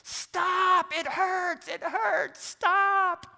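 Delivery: high, loud pained scream; piercing cries of pain, mimicked at full force; screaming child. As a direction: imitate a small boy screaming for it to stop. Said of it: "he is screaming at the top".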